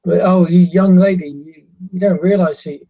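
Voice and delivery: animated chess player calling across. Acted. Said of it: "he gives the comments of sort of".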